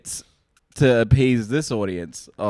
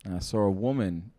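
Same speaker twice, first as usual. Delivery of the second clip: stand-up joke telling; 3 semitones lower than usual. Acted. Performs a stand-up bit.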